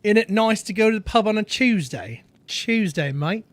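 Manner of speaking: put-on a shaky go at a London pub accent; bad British accent. Streamer admits a bad British accent, then tries it. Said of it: "I can't do the accent very well".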